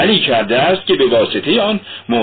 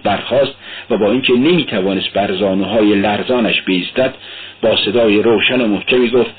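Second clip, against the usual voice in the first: ordinary delivery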